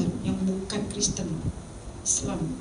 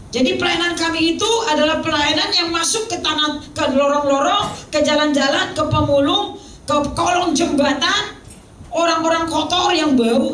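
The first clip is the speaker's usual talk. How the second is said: high, insistent list from the pulpit; fervent preacher's cadence; emphatic preaching; 8 semitones higher, 4 dB louder than usual. Real, not acted. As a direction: build the list with raised pitch. Preaches list at raised pitch.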